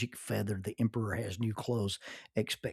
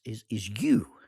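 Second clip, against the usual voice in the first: usual speaking voice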